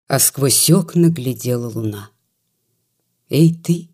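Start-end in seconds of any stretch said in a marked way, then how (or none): none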